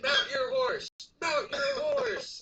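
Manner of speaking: high, loud, slow shouted command; shouting. Retold or imitated. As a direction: bellow it like a cavalry officer. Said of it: "I said".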